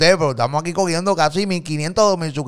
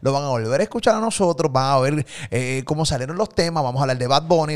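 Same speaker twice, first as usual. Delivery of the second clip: ordinary delivery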